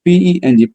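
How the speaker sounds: পিইএনজি spelled out high and slowly, falling at the end; teacher spelling পিইএনজি; spelling demo. Shown as a demonstration. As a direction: spell পিইএনজি letter by letter for the class. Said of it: "উচ্চারণগুলো ভালো মতো শুনেন".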